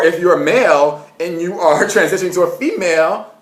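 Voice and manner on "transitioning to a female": ordinary talk, nothing marked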